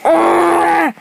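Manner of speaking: yelping in pain; pained cry. Real, not acted. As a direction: cry out from a hurt foot. Pain after being hurt.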